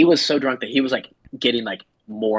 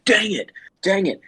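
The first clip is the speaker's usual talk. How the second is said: high-pitched exasperated outburst; mock frustration; 8 semitones higher than usual. Tony hears they are dating, high mock frustration. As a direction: act out his dismay, pitched up high. Acted drunk man's frustration.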